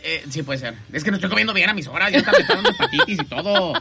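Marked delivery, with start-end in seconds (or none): 2.1-3.8 laughing. Real, not acted. Laughs at own hepatitis joke.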